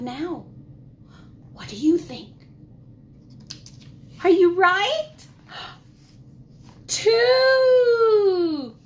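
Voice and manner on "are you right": plain reading voice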